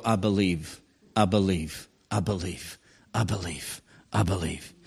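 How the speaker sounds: low, soft murmured "I believe"; hushed murmur. Retold or imitated. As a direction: murmur it to yourself, lying in bed. Murmured repeated confession in bed.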